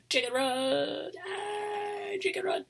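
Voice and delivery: shrieking Chicken Run with glee; excited cheer. Real, not acted. Cheering the movie title.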